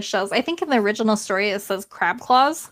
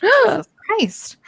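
shocked exclamation; 12 semitones higher, 4 dB louder, slower than usual. Real, not acted. Shocked reaction while reading.

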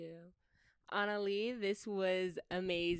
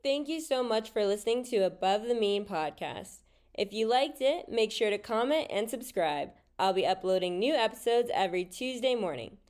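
louder, upbeat host sign-off; cheerful louder sign-off to listeners; podcast outro read; 7 dB louder than usual. Acted. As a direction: wrap up the show like a host reading the outro.

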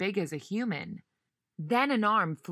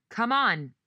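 loud, higher call over a helicopter; yelling over noise; 5 semitones higher, 5 dB louder than usual. Acted. Dan pulling Jane past the helicopter, yelled. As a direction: shout to be heard over rotors. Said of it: "yelled over the noise".

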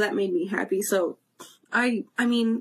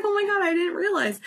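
surprised realization; 9 semitones higher than usual. Retold or imitated. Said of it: "was like".

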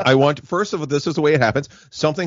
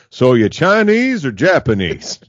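louder, higher swoop on Chinese; Hank Hill; 4 semitones higher, 5 dB louder than usual. Acted. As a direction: blankly repeat the question, stretching Chinese high. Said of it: "just a stunned look on Hank's face".

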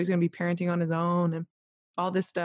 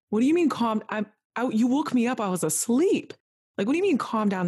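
higher, punchy retort, retelling an argument; indignant protest; 5 semitones higher than usual. Retold or imitated. Woken patient objects to being told to calm down, indignant. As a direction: push back in mock indignation, pitch lifted.

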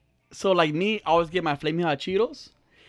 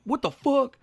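higher, questioning mock indignation; mildly mad; 6 semitones higher, slower than usual. Imitating Steph demanding an explanation, mildly mad. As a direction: imitate her baffled protest without raising volume. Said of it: "I think she's mad".

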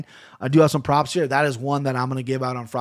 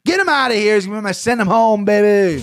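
high, loud catchphrase yell; hyped shout; 7 semitones higher, 5 dB louder than usual. Acted. Podcaster announcing his favorite pick, hyped shout. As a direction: belt out the catchphrase.

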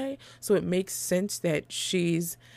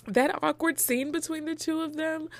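laughing it out, much higher; 10 semitones higher than usual. Real, not acted. Podcaster laughing at an awkward movie scene, high and giggly. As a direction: giggle through the awkward part, pitched way up. Repeats phrase, then laughs.

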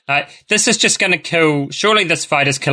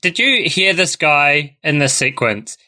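normal talking voice

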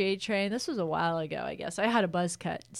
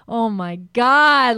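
1 amazed; 4 semitones higher, 12 dB louder, much slower than usual. Retold or imitated. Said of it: "And I was like".

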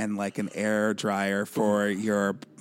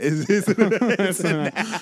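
laughing; 7 semitones higher, 4 dB louder than usual. Real, not acted. Laughing repeat.

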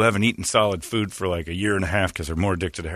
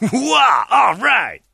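high, loud fake cheer; mock delighted voice; 12 semitones higher, 5 dB louder, slower than usual. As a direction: cheer with hammed-up joy. Voicing delighted people.

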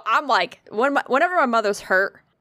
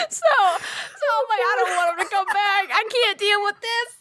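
laughing; 9 semitones higher than usual. Real, not acted. Still laughing.

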